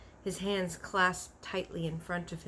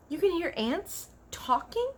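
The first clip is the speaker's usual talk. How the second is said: high, astonished question; a child who can hardly believe it; girl's voice rising in wonder; amazed girl; 12 semitones higher, 5 dB louder than usual. A narrator's reading. Sophie amazed in a story.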